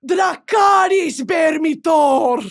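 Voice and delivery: slow shouted battle cry; High Valyrian war cry. Pretend. Showing a friend a Dothraki impression, slow shouted war cry. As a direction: bellow it like a savage horseman. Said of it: "Here's my Dothraki".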